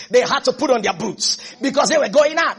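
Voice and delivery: ordinary talk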